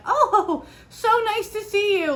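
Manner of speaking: high, forced-bright pleasantry with a laugh; sugary fake politeness while stressed; fake cheerful greeting. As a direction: mom greeting her old ballet teacher in mock delight. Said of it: "I'm like".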